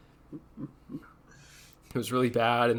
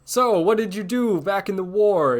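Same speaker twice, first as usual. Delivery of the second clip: mock mobster interviewer; 8 semitones higher, 3 dB louder than usual. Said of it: "They're like".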